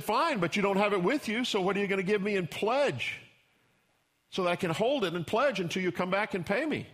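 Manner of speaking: Tamar bargaining. Retold or imitated.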